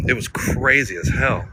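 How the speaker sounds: usual speaking voice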